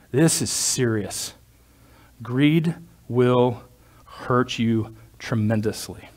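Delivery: usual speaking voice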